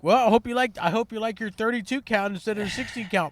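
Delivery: loud, high mock-sarcastic taunt; sarcastic jab. Driver imagines taunting a customer, loud sarcastic jab. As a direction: needle the customer with fake sweetness. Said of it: "So it's like".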